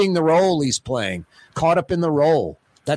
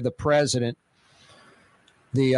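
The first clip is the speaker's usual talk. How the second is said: ordinary talk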